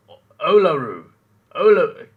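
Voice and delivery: Olaru, opening on "oh", very loud; Uluru with "o". Shown as a demonstration. Streamer checks with chat, Uluru loudly started on "oh". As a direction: belt out the "oh" in Olaru. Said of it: "are you saying oo or o?".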